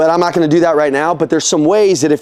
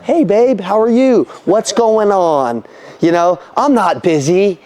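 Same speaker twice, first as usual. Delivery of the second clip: put-on sweet husband; 2 semitones higher, slower than usual. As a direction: answer your wife as if you had all day. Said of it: "I gotta be like".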